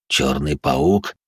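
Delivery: low, slow, puzzled question; understated surprise, drawn out; surprised. A narrator's reading. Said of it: "удивился иной".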